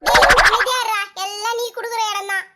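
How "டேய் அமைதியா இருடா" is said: loud scolding command; loud order. Acted. Mother telling son to shut up, loud. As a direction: shout him into silence.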